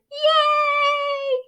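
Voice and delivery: very high squeal of joy; cheering yay. Acted. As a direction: squeal with glee. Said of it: "the crowd cheered".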